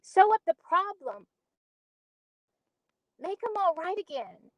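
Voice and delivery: put-on earnest storyteller recalling a childhood accident; Beneatha monologue. Auditioning actor recalls Rufus's sledding accident, earnest dramatic monologue.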